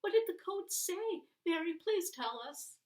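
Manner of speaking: put-on high, loud boyish pleading; a small boy who can't wait for the answer; boy pressing for the end of the story, high and eager. A narrator's reading.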